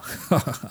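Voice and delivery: short mocking ha-ha-ha; scornful laugh. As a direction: laugh derisively at them. Acted scornful character laughing. (szyderczy śmiech)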